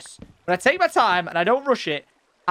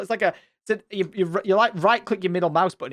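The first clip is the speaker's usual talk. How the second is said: ordinary delivery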